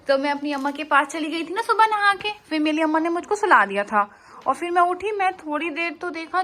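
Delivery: a hint of baby talk. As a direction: tell it like an eager little girl. Voicing baby retelling her day.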